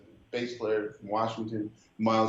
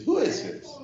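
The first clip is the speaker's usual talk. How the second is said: put-on girlfriend's voice.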